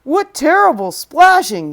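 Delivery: a frowning elephant's complaint. Acted. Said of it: "the elephant frowned".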